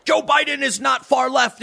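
high slow outraged yell; hammed-up offended leftist shouting; mocking indignant shout. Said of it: "the left is like".

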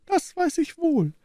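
very high woman's character voice; put-on high voice of a cornered wife; conceding wife. A narrator's reading. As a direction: give in with a high, plaintive reply. Wife concedes under his reproaches.

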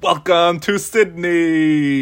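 loud, slow, high town crier call. Retold or imitated. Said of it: "That he just screams".

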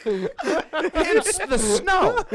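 high laughing words. Real, not acted. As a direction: say it through laughter. Laughing at the joke.

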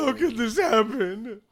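sobbing, choked grief; breaking down over his dead wife; crying widower. Acted. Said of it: "and he's crying".

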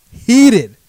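HEATED shouted high and loud, falling at the end; emphatic loud repeat. Retold or imitated. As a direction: slam the word Heated with rising fury.

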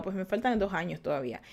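normal talking voice

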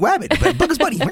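squeaky cartoon bunny impression; Bugs Bunny voice. Joking with co-host, does a high Bugs Bunny voice.

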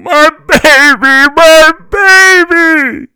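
very high, loud, drawn-out scream; screaming mother. A narrator's reading. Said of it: "was still screaming".

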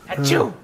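real sneeze; sneezing. Real, not acted. Bless you.

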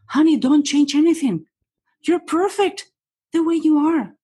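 high-pitched, emphatic reassurance. Acted. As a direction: reassure them insistently, voice lifted high. Voicing reassurance to a client.

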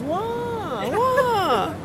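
put-on very high, indignant Mandarin-accented squawk; imitated Mandarin accent. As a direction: mimic the accused coworker in a piercingly high Mandarin accent. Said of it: "she would say with the accent".

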